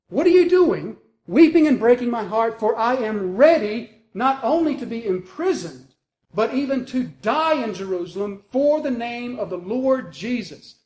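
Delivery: high, loud outburst of resolve; impassioned protest. Acted. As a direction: burst out at weeping friends, utterly determined. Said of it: "Then Paul answered".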